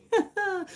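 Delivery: high, short real laugh; giggle. Real, not acted. Streamer amused at maybe misreading a viewer's name, giggle. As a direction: burst into a brief high giggle.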